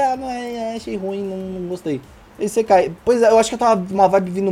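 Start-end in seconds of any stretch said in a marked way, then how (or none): 0.0-2.0 fading mock complaint, softer; soft, dismissive retelling; trailing off. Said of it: "eu falei". (desanimado, cada vez mais baixo)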